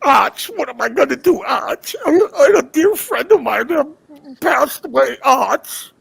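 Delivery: mock pleading. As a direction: whine to Arch like a mocked hanger-on. Mimics a man pleading to Arch.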